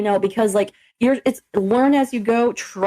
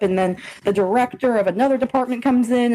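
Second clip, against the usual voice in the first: normal talking voice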